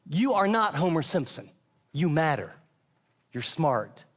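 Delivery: ordinary delivery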